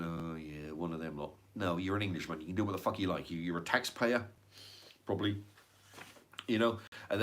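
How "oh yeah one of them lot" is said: muttered sneer. Retold or imitated. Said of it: "who are they to be going".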